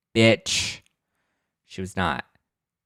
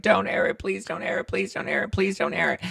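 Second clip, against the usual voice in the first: begging; 9 semitones higher, 3 dB quieter than usual. Retold or imitated. Cast begging producers.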